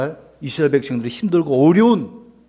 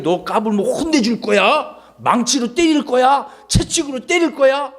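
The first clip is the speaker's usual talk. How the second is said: mock threat; 7 semitones higher than usual. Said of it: "무서워하라는 말이 아니에요".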